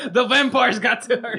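high, loud, slow joke through laughter; playful dramatic outburst with laughs; laughing mock alarm. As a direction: crow the silly theory, laughing. Joking along about dragons in Romania.

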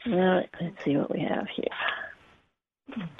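slow, soft whisper while drawing cards; whispering to herself. Real, not acted. Reader whispering to herself as she pulls the cards. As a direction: hush it as if peering at the cards.

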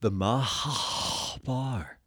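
drawn-out, odd voice; slow put-on voice. Co-host naming her location, slow and odd. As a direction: say it slowly in a strange voice.

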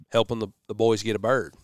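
usual speaking voice

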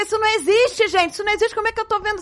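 high-pitched, incredulous protest; panicked disbelief. Retold or imitated. Woman voicing her thoughts on seeing the impossible, incredulous.

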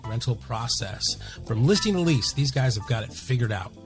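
soft, even sponsor read. Acted. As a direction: read the sponsor spot smoothly. Sponsor ad read over music.

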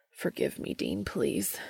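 soft, whispered, pained plea; anguished plea. A narrator's reading. Cass pleading with Dean on the bridge, anguished whisper. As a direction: beg forgiveness under your breath. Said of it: "the anguish in his voice".

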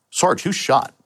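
dispatcher's high, worried radio call; alarmed question. Retold or imitated. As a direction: ask who's been hit, alarmed.

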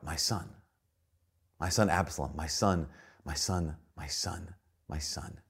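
hushed, grieving cry of a father; crying out in grief. Retold or imitated. David mourning his son, a soft grief-stricken cry. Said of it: "David cries out".

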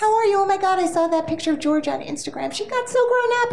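high, bubbly chit-chat; gushing small talk. Retold or imitated. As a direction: gush like a friendly casting director. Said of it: "civilian conversation with the casting director".

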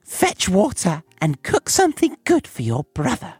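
high-pitched bossy witch; storybook witch giving commands; witch crying out orders. A narrator's reading. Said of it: "she shook Gretel and cried".

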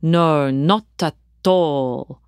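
put-on slow Italian accent with hard, crisp T sounds in "not at all"; "not at all" in a light Italian accent, every T in "not" and "at" sharply hit. Father at dinner party answering guests, light Italian accent. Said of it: "His Italian accent emphasised the T sounds".

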